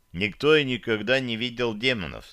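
usual speaking voice

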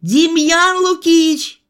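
high, drawn-out yell for help; long, high-pitched call. A narrator's reading. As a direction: holler for the assistant down the corridor. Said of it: "и завопил".

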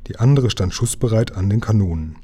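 ordinary delivery

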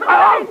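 sharp high yelp of pain, loud. Acted. Thug struck by the umbrella, yelping in pain. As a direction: yelp as the blow lands.

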